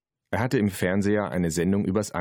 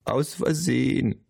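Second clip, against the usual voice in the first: slow, high grandma voice; 7 semitones higher, slower than usual. A narrator's reading. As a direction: draw it out in a raised grandma voice. (Oma-Stimme, hoch und gedehnt)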